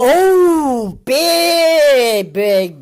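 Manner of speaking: high, loud, drawn-out reaction; loud, high outburst at what was just heard; emphatic exclamation. Real, not acted. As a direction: cry out the alarm, stretching the words. Reacting to played clip, exclaiming.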